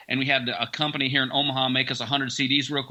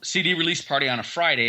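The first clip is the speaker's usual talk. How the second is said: normal talking voice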